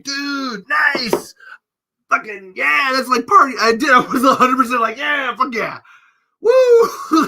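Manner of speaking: high, loud whooping enthusiasm; hyped-up shouting, building to a whoop; party hype. Retold or imitated. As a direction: cheer on a fellow partier at a concert. Acting out party hype at concert.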